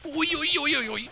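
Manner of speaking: high-pitched running string of oi's; excited oi-oi. Real, not acted. Caster reacting to the skirmish, excited oi-oi. Alarmed exclamation at the fight.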